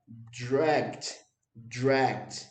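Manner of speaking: "dragged" as one syllable, no E sound; dragged pronunciation. Shown as a demonstration. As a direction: end "dragged" on a crisp T, no vowel. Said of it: "A pronúncia disso daqui é". ("dragged" numa sílaba só, sem o E)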